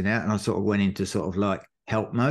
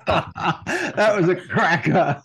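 much higher, slow words through real laughter; laughing; 9 semitones higher, 4 dB louder, much slower than usual. Real, not acted. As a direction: still cracking up at the joke. Laughing at the funny sign.

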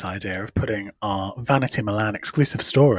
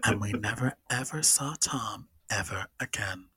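ominous narration; 3 semitones lower, 10 dB quieter than usual. Pretend. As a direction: close it like a spooky tale, quietly. Spooky storybook ending.

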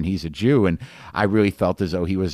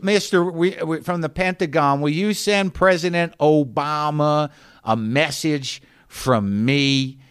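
high, drawn-out imitation of a pleading passenger; mock little-kid plea, slowing toward the end; childlike attention-seeker; 7 semitones higher, slower than usual. Woman calling after the departing official, childishly. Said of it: "like an eight-year-old would do".

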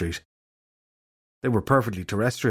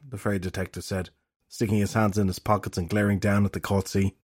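plain reading voice

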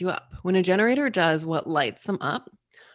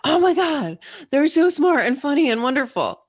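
much higher and louder, thrilled; gushing delight; 8 semitones higher, 6 dB louder than usual. Pretend. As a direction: rave about someone you just met. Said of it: "You're gonna be like".

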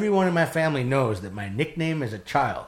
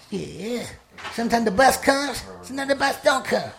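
high-pitched, chanting madwoman voice, building louder; muttering-to-herself street lady act; 12 semitones higher, 4 dB louder than usual. Retold or imitated. Homeless woman rants to herself about the bus, high and agitated. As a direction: put on a shrill, singsong ranting street character. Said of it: "she's talking to herself".

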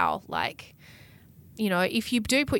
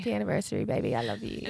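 soft, tender anniversary words; romantic; 8 semitones lower, 5 dB quieter, faster than usual. Real, not acted. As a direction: murmur it lovingly to your partner. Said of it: "here we are being all mushy".

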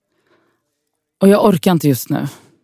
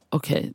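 weary sigh; 9 dB quieter than usual. Acted. Sigh before crying call.

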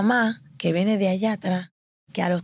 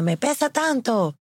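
put-on retelling the doctor's excited shout about the baby; doctor calling out; 7 semitones higher than usual. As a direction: play the amazed doctor calling across the room. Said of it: "la doctora voceando".

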